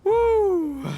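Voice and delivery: relieved woo. Acted. Relief after the attack.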